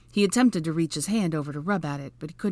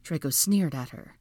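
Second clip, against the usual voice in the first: usual speaking voice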